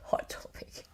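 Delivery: breathy whispered repeat. Shown as a demonstration. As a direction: whisper it back.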